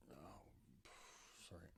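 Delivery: sudden pained whisper, then a groan; having a back spasm. Real, not acted. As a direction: gasp and wince through a back spasm. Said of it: "Little back spasm there".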